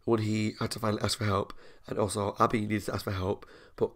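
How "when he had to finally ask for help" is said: ordinary talk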